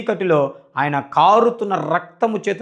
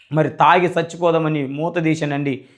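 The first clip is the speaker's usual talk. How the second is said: usual speaking voice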